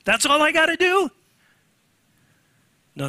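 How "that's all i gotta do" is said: high, disbelieving squeal; mock disbelief. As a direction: act stunned it could be so easy. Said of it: "I'm going".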